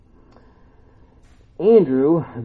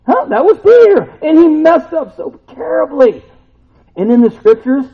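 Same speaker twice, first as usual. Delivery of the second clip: much higher and louder, nearly shouting; full-force preaching; 14 semitones higher, 11 dB louder than usual. Acted. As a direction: preach with rising fire.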